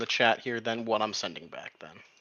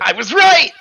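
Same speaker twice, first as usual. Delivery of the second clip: loud, high cry of victory; triumphant shout; 16 dB louder than usual. Real, not acted. Player gloats at the table, a triumphant shout. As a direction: crow that you were right.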